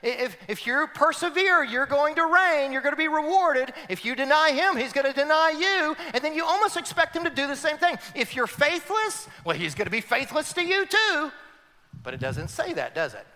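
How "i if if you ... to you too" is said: high, loud, building preacher's cry; full-force preaching. Acted. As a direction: preach it out to the whole church.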